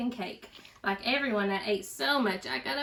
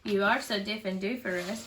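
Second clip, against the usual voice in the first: ordinary delivery, nothing marked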